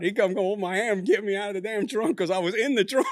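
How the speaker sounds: laughing loudly through the story; cracking up while telling it. Real, not acted. Laughing while telling friends about falling in the trunk.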